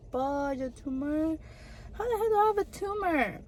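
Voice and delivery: can't believe it, rising up; disbelief. Retold or imitated. Reacting to tumor news.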